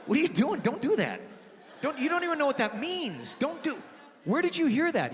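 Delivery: high, flustered mock scolding; alarmed scolding. Dad scolds son over rude noise, alarmed and high. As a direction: stop the kid, acting appalled.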